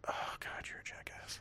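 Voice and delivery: whispered teasing insult; hushed jab at a friend. Real, not acted. Teasing friend under breath.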